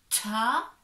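"ta" loud and crisp; syllable demo. Shown as a demonstration. Teacher joins T with A, loud and emphatic. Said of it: "ça fait".

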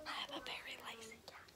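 soft unvoiced whisper; breathy hush so mum stays asleep. Real, not acted. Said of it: "I really wanna be quiet".

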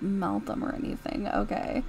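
ordinary talk

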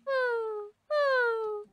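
high, drawn-out "woo"; bird cry. Pretend. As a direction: imitate a bird hooting. Imitating the upset bird.

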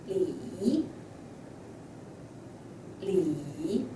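"li" dipping low, then rising; tone test word. Shown as a demonstration. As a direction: scoop "li" down and back up. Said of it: "you tell me what tone is it".

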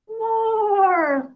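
put-on a giggly, delighted baby begging; laughing. A narrator's reading. Baby laughing up at daddy, high and delighted. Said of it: "laughs little guy".

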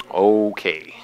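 low, quiet, drawn-out disbelief; skeptical okay. Retold or imitated. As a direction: humour them with a low, doubtful okay. Skeptical reply to friend's tall tale.

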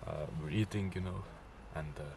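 hushed, uneasy half-whisper; nervous night vlog whisper. Real, not acted. Retelling scratching noises, hushed at night.

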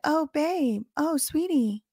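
mocking sugary mom. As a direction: sneer through a syrupy sweet-talk. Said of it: "You think that's how she said it?".

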